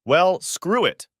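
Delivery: defiant exclamation; exclaimed. A narrator's reading. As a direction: throw the words out in bitter defiance. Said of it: "I exclaimed".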